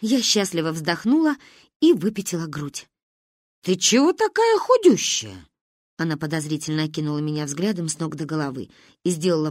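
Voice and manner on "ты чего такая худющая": high, swooping exclamation; blunt astonishment. Acted. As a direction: marvel at the child bluntly. Grandmother exclaims at skinny child.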